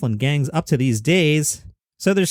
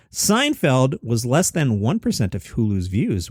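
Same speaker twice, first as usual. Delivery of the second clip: usual speaking voice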